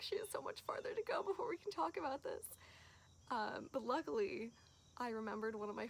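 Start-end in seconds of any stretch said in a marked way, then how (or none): 0.0-2.5 mock exasperation. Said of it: "so I was like".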